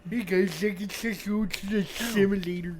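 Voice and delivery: lightly voiced goofy kid; a hint of a silly kid; mocking child voice. Teasing a friend about the spaceship simulator, mock kid voice.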